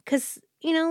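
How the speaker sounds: ordinary talk, nothing marked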